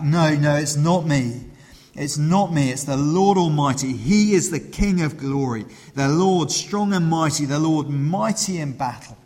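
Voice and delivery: David shouting back. Retold or imitated. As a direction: shout back to the crowd with conviction. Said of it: "And David shouts back".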